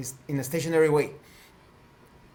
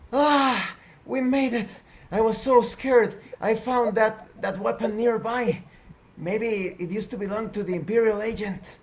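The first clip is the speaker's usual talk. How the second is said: yelling; 7 semitones higher than usual. Acted. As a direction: cry out high, then ease off a little.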